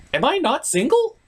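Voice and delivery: ordinary talk, nothing marked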